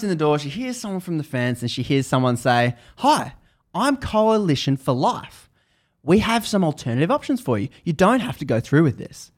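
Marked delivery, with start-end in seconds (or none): 3.0-9.3 friendly pitch. Retold or imitated. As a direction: imitate an activist calling out an offer, pitched up. Pitching options through the fence.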